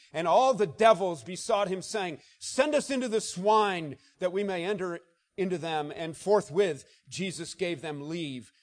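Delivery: preacher reading the madman's cry at full force; preaching scripture. Acted. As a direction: thunder the verses out.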